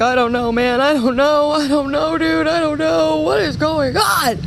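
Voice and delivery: building high, frantic wail; mock panic. Voicing a scattered listener crying out to God, mock-panicked.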